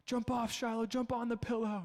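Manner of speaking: coaxing dad. Retold or imitated.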